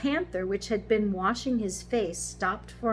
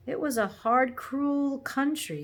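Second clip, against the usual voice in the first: higher, emphatic, slowing complaint; grievance; 6 semitones higher, 4 dB louder than usual. A narrator's reading. Horse recalling London streets, aggrieved. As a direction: lean heavily on the hardship.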